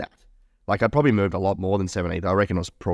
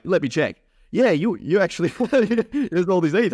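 lightly voiced finance manager, higher and laughing; 9 semitones higher than usual. Finance manager telling him about forgotten eth, light high voice. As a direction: play the manager finding the coins.

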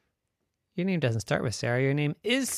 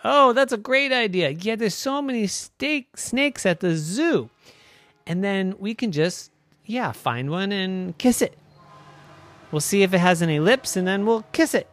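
higher, louder, eager; excited little girl; 8 semitones higher, 6 dB louder than usual. Acted. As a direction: bubble over at the plan. Mary thrilled by zoo idea.

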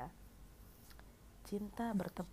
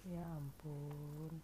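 soft sigh; 2 semitones lower, 7 dB quieter, much slower than usual. Real, not acted. Reaction to a sad quote.

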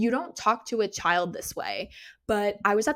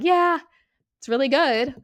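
put-on bright high giggly kid reply, louder; laughing it off; 5 semitones higher, 7 dB louder than usual. Sixth grader laughing off her friend's mom. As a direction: brush it off cheerfully like a kid.

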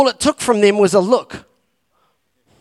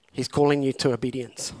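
tender appeal; 6 semitones lower, 7 dB quieter than usual. Acted. Repeated appeal, soft.

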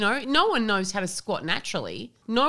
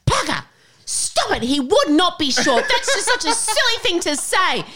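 much higher, louder, laughing indignant scold; indignant mum; 12 semitones higher, 6 dB louder than usual. Retold or imitated. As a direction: scold the grandchild in mock outrage. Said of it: "she goes".

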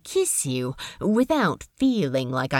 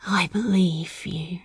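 whisper. Acted. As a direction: whisper it close to his ear. Intimate reply in bed.

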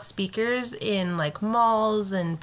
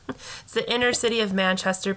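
normal talking voice

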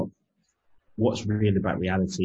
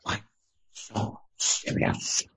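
slow breathy Joker line; drawn-out menacing Joker impression; Joker quote; 4 dB quieter, much slower than usual. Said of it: "where that one's from? Joker".

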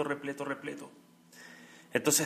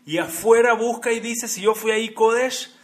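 emphatic preaching; 7 semitones higher, 11 dB louder than usual. Acted. Sermón enfático, cita del Rab.

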